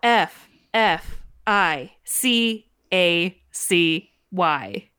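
slow letter by letter spelling. Shown as a demonstration. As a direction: spell each letter out slowly. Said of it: "I'm gonna spell it for the listeners".

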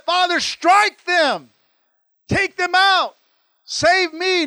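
high, loud, slow cry for revenge; angry demand. Pretend. Jesus imagined cursing his mockers, high and loud. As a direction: hurl it up to heaven, furious. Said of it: "instead of getting mad".

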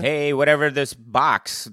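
loud, high, drawn-out mimicry; mock overconfidence. Co-host imitating a diver who trusts the computer, loud mockery. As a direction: play the careless diver, big and loud.

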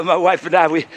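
usual speaking voice

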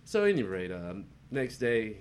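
normal talking voice

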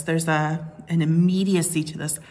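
ordinary talk, nothing marked

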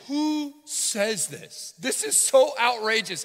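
high, loud, very slow mocking voice; over-the-top scandalized royal; mock outraged king. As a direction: ham up the proud king. Mocking prideful speech.

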